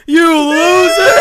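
high shouted jeer through laughter; laughing shout. Pretend. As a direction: yell it while cracking up. Crowd jeering at kid.

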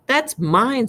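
high-pitched indignant objection; indignant protest. A narrator's reading. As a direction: protest as it is snatched away. Boy protests as letter is snatched.

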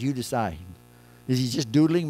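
ordinary talk

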